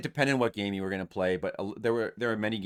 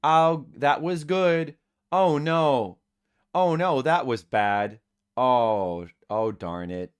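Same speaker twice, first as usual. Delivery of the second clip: raised, drawn-out mock cheers and dismay; slow, louder sample reactions sinking at the end; moderated excitement; 6 semitones higher, 6 dB louder, much slower than usual. Shown as a demonstration. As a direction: hold the excitement to a measured level. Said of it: "I will moderate my excitement".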